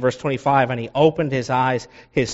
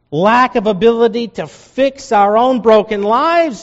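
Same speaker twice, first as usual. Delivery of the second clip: high, loud impassioned preaching; 11 semitones higher, 5 dB louder than usual. Acted. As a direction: preach it with full fervour. Preacher's opening build.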